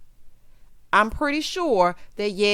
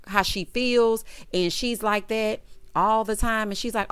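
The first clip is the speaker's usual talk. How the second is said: ordinary delivery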